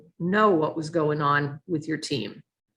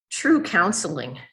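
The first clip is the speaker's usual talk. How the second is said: ordinary talk, nothing marked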